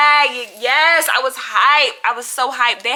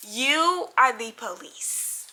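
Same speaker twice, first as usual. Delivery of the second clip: ordinary delivery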